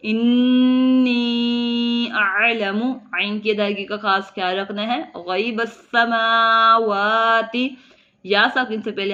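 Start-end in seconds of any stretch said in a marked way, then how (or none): 0.0-2.9 slow, drawn-out Quranic chant with held vowels; Quran recitation. Shown as a demonstration. Teacher reciting the verse for students, slow tajweed chant. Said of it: "teacher recites verse in tajweed". 5.2-7.8 slow, drawn-out Quranic chant with held vowels; Quran recitation. Shown as a demonstration. Teacher reciting the verse for students, slow tajweed chant. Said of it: "teacher recites verse in tajweed".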